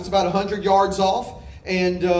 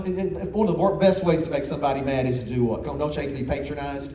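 ordinary talk

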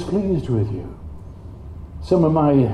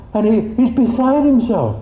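excited; 9 semitones higher than usual. Real, not acted. Preacher to congregation, bursting with excitement. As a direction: burst with joy at God's giving. Excited about God's joy.

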